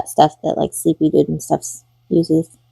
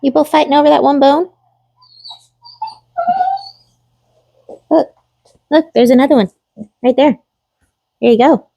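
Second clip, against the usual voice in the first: talking to pets; 8 semitones higher, faster than usual. Real, not acted. Talking to pets over bones.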